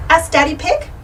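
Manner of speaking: ordinary delivery, nothing marked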